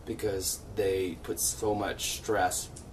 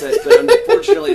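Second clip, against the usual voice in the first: laughing through the words; breaking into high laughter; 16 dB louder than usual. Real, not acted. Laughs while explaining.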